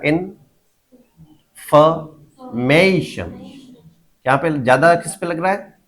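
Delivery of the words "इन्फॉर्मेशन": इन्फॉर्मेशन broken into four drawn-out syllables with pauses; very slowly, इन्फॉर्मेशन as in-fa-may-shan. Shown as a demonstration. Teacher showing students where stress falls, slow syllable-by-syllable. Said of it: "कम stress यहाँ पे लग रहा है".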